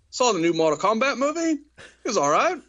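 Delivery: high-pitched mock-casual verdict, a shrug in the voice; YouTube reviewer impression. YouTuber shrugging off the new movie, high mock-casual voice. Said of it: "And then he just is like".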